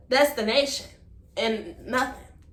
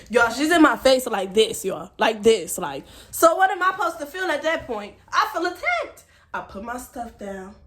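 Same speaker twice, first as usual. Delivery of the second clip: ordinary talk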